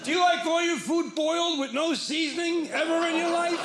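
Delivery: high and loud, laughing all through; shouted giggling pickup line; laughing mock shout.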